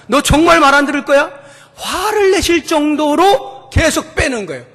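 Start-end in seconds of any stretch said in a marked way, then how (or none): 0.1-1.3 angry rebuke. Retold or imitated. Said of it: "화가 나서".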